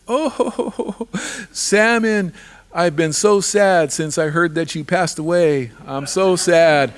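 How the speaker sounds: high, whiny fake sobbing; fake crying. Rattlesnake fakes tearful grief to Salmon, high and whiny. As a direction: Rattlesnake pretends to grieve to fool Salmon. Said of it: "he pretended like he was crying instead".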